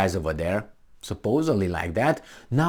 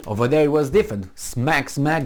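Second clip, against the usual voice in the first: ordinary talk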